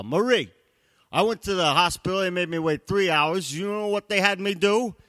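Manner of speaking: loud, high-pitched mock tirade; indignant husband. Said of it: "I march up the stairs and I go".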